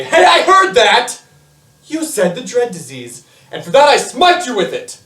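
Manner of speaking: booming, high-pitched shout of divine wrath; God. Acted. [name] imitates the wrath at saying the word aloud, booming shout. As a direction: thunder it out, hammed-up and drawn out. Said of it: "then God would just say".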